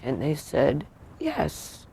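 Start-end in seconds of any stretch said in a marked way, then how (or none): 1.2-1.9 high mock-perky yes. Hospital staff confirming death, mock perky yes. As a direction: chirp yes like a cheerful nurse. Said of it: "all perky".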